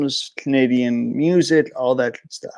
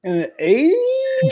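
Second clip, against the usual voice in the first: much higher, slowed, cracking disbelief; incredulous. Real, not acted. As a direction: stretch it out, can't believe it. Disbelief at teacher's claim.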